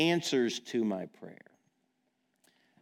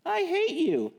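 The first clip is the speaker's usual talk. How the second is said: mock tantrum child; 5 dB louder than usual. Child shouting at parent.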